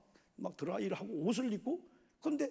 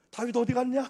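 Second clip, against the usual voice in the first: loud, raised-pitch question; calling out; 6 semitones higher, 9 dB louder than usual. Acted. Saul looking for David in the armour, calling out. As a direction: call out searching for someone.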